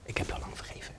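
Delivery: whispered, barely audible; very soft voice. Retold or imitated. God answering the kneeling man, whispered gently. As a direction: breathe the words like a gentle whisper. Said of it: "maar hij was superzacht".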